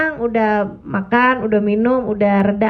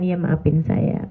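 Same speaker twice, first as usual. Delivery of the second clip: slow, softer meek apology; soft apologetic; 6 semitones lower, 3 dB quieter, slower than usual. Retold or imitated. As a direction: say sorry gently and humbly, low. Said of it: "Kalau perlu kamu minta maaf". (ucapan maaf yang lembut dan pelan)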